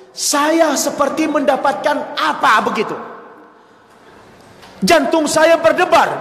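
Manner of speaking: high, rising excitement; overwhelmed. Retold or imitated. Father describes arriving at the pesantren, overwhelmed.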